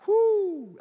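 a high, loud whoop of amazement; excited whoo. Real, not acted. Preacher whooping at the congregation over his own point, excited. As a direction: let out a sudden high whoo.

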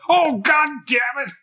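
loud, high, slow cursing impression; hammed-up exasperated movie-character yell; Wilford Brimley. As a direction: holler it out in a put-on character voice. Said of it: "I just have Wilford Brimley's voice".

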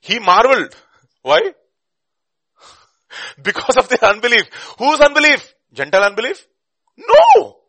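high, shouted rhetorical questions and answers; preacher shouting at full pitch; full-force preaching. Real, not acted. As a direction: fire out each question, then thunder the answer.

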